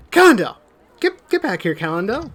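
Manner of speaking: mother calling her son back; calling a child. Acted. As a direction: shout for the runaway boy. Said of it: "you hear".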